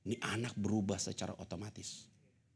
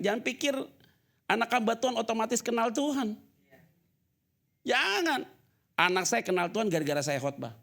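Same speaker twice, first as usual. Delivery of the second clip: ordinary talk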